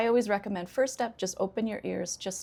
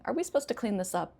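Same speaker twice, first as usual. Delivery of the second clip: quick, high start, falling away; sarcastic complaint; 4 semitones higher, faster than usual. Shown as a demonstration. Teacher voicing someone unwilling to clean up, complaining. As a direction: complain through a falling question. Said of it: "I'm complaining".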